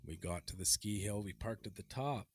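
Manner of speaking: low, soft, deliberate storytelling; story narration. Acted. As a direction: tell it hushed, as a solemn tale. Hushed mock-solemn story narration.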